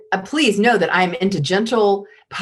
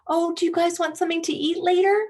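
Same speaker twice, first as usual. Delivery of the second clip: much higher, fast, sing-song asking; pleading question; 11 semitones higher, faster than usual. Retold or imitated. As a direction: chirp it up as a timid question. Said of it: "They don't say".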